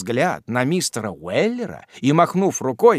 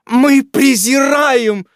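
high, loud, slow indignant shout; loud slow scornful shout; outraged shout; 11 semitones higher, 7 dB louder, slower than usual. A narrator's reading. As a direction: hurl it back in contempt. Outraged wife scolds.

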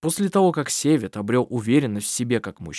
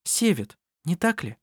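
higher-pitched character voice; lightly voiced woman, pitched up; Anna-Maria's line; 6 semitones higher than usual. A narrator's reading. Anna-Maria guessing who her neighbour is, higher voice.

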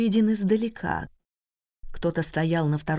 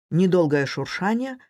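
ordinary delivery

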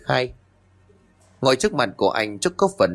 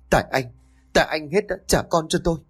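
angry; 5 semitones higher, faster than usual. Acted. Grieving wife blames husband.

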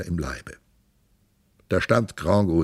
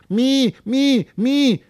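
put-on very high mewling baby cry; newborn crying; 4 dB louder, much slower than usual. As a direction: squeal like a newborn. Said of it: "schrie es nicht wie andere Säuglinge".

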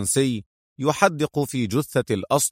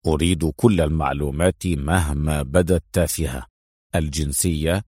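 low, grave instruction; serious; 8 semitones lower than usual. A narrator's reading. Adham orders every detail from Mona, serious. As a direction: list the demands gravely, pitched down. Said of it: "قال أدهم بجدية".

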